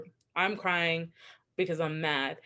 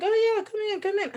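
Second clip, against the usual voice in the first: imitating cheery dad; 4 dB louder, faster than usual.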